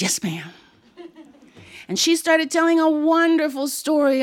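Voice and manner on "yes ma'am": put-on meek, low child's whisper; whispered obedience. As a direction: whisper your answer to a stern aunt. Child obeys the stern aunt.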